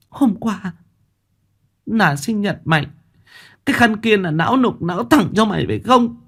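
high-pitched wounded accusing; bitter accusation. A narrator's reading. Chanh pointing at Lam's scarf, accusing.

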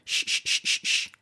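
repeated short SH, like hushing someone; 'sh' as if telling someone to be quiet; shushing sh. Shown as a demonstration. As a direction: shush in even, voiceless bursts. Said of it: "es como mandar callar".

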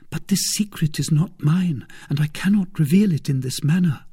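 put-on a touch of firm refusal in a young woman's voice; troubled young woman. A narrator's reading.

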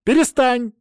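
high loud bark. Acted. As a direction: snap it out to stop him. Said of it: "рявкнул я на него".

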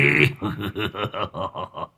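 drawn-out villainous cackle; evil laugh. Acted. Old sorcerer gloating over Long Tứ, evil laugh.

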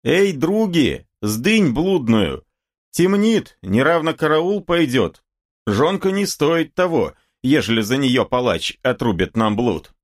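high, urgent warning call; high-voiced ringleader raising the alarm; calling to cronies. A narrator's reading. Boyar's son calls to his cronies.

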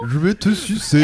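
cheeky voice from trash can; talking trash can voice. Pretend. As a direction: play the park's talking bin. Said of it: "La poubelle parlante".

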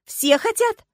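very high, loud exclamation; astonished insistence. A narrator's reading. Agata sure that everyone wants marriage, astonished. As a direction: insist it as obvious truth.

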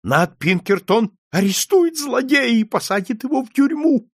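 plain reading voice

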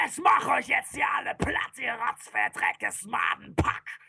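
strained battle yell, slowing at the end; demon's roar. Acted. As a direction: roar a threat at the whole pack. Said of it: "brüllte da mit jedem Schlag".

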